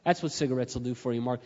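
normal talking voice

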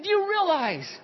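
high, shouted, preacherly encouragement; fervent reassurance. Retold or imitated. Pastor assuring his spiritual daughter she is loved, fervent shouting. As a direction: shout down every doubt with conviction.

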